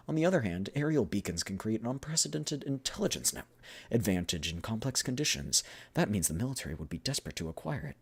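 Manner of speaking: lightly acted formal pitch; eager business speech; persuasive negotiator. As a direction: argue the military advantage in character. Continues the character's business pitch.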